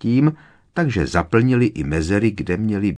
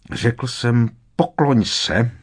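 raised, higher-pitched order; Voldemort's command; 6 semitones higher, 4 dB louder, slower than usual. A narrator's reading. Villain ordering Harry to bow after he refused, commanding. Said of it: "přikázal mu Voldemort".

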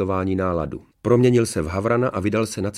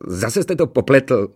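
high, loud, slow grumble; aggrieved dwarf; 5 semitones higher, 6 dB louder, slower than usual. A narrator's reading. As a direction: scold the master like a wronged servant. Ukřivděný trpaslík kárá pána. (ukřivděně a vyčítavě)

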